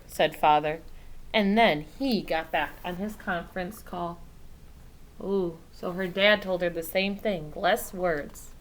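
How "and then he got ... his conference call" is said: ordinary delivery, nothing marked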